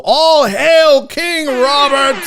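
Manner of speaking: loud, drawn-out heralding cry; mock-royal proclamation. Host crowns the contest winner, loud mock-royal proclamation. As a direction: proclaim it high and slow to a crowd.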